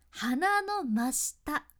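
鼻の真下 drawn out slowly and pressed; very slow stress. Shown as a demonstration. Coach pointing to where the voice comes from, very slowly. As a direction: linger on 鼻の真下. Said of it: "鼻の真下から声を出す".

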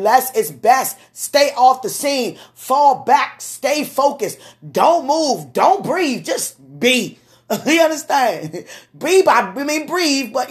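high, loud preaching bursts, short commands; preaching at full force. Acted. Preacher urging the listener to stay still, preaching hard. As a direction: drive each command home from the pulpit.

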